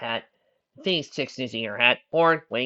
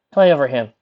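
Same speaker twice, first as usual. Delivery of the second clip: ordinary delivery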